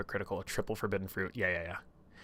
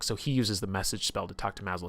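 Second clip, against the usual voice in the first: normal talking voice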